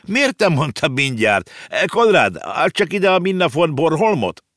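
high, loud eager calling out; exclaiming. A narrator's reading. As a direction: keep calling out, impatient for the book. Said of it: "kiáltott fel Ringelhut". (izgatott, hangos felkiáltás)

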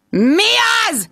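high yell into the intercom. Acted. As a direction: bark it into the intercom. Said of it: "ordítottam bele a kaputelefonba".

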